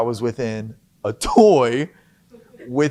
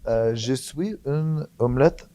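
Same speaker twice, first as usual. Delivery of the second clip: French accent; 2 semitones higher than usual. Shown as a demonstration. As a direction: show off halting French with the accent. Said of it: "In my little French accent".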